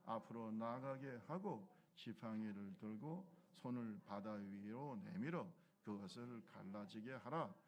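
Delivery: slow, solemn Bible reading; scripture reading. Acted. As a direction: read God's words slowly and weightily. Reads scripture aloud.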